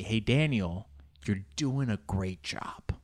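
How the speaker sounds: slow, breathy whisper; wished-for whisper. Retold or imitated. Imagining the instructor whispering praise to him. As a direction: whisper the praise you long to hear. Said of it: "I just want her to say".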